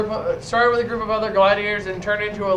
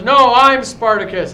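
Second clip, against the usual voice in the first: loud defiant claim; loud declaration; 8 dB louder than usual. Retold or imitated. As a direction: step forward and claim it loudly. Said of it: "stands up and goes".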